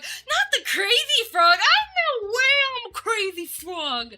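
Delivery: high-pitched, hammed-up wail; mock horror. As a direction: cry out in melodramatic dismay. Mock horror at becoming crazy frog.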